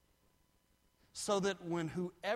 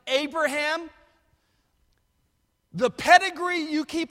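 loud, much higher shouting sermon, slowing at the end; full-force preaching; 12 semitones higher, 14 dB louder than usual. Acted. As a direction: thunder the climax over the congregation.

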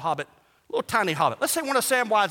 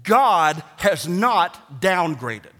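usual speaking voice

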